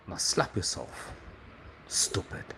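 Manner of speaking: whispered, slow and low; low unvoiced mutter. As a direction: hiss it under your breath. S1 mutters insult.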